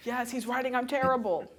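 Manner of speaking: slightly hammed-up anxious self-doubt; mock panic. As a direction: blurt it like a rattled auditioner. Acting out panicked self-doubting auditioner.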